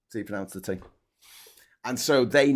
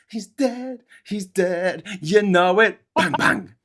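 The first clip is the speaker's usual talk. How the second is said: high, sing-song gloating; mock gleeful widow; 7 semitones higher, 4 dB louder, much slower than usual. Mocking the widow at the fireworks, high and gloating. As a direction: crow it like a widow dancing on stage. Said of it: "there she is on stage".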